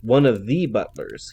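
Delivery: a hint of New Zealand drawl, sizing up a rival. A narrator's reading. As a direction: bodyguard taunting Butler in a light New Zealand drawl. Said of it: "he said in a New Zealand drawl".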